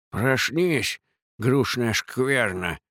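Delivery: lisping, slow brutish growl; slushy lisp. Acted. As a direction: spit it out with a thick lisp. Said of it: "Шепелявый голос обратился".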